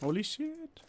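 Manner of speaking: soft, high rising exclamation; quietly stunned, voice sliding upward; hushed amazement. Real, not acted. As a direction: let the pitch climb high, under your breath. Startled by game event.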